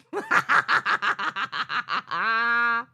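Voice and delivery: bitter laugh burst; a short disbelieving laugh; rueful laugh. Real, not acted. Laugh after sarcasm.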